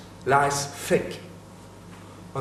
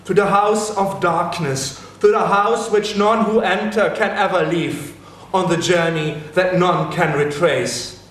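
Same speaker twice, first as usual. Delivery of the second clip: loud, raised, declaimed with dread; loud dread declamation; 5 semitones higher, 5 dB louder than usual. Acted. Dying friend tells his vision of the underworld, loud dread declamation. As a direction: proclaim the house of darkness to the heavens.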